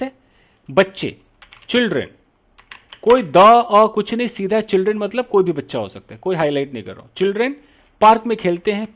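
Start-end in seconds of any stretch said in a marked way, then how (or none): none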